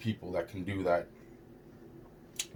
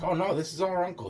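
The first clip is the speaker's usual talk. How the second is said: higher, fast, eager mock voice of a trusting kid; imitating naive cousin; 9 semitones higher, faster than usual. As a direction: play the naive cousin, brightly dismissing the worry. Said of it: "And he's like".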